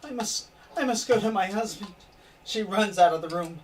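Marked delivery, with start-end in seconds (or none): 0.0-1.9 higher, welling-up tearful apology; tearful wife. Acted. Wife near tears leaving the guest room. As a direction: hold back tears as she excuses herself. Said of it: "she goes".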